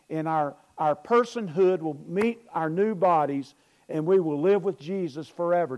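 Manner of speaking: loud, rising sermon voice; fiery pulpit delivery; full-force preaching. Acted. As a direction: preach it out to the whole room.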